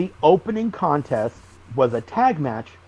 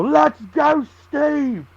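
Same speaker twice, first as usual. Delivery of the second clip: high-pitched mock drunk heckle; high mock chant from a drunk fan; drunk fan yelling; 12 semitones higher, 4 dB louder, much slower than usual. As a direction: bellow it like a drunk fan at the rail. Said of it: "And you just hear him go".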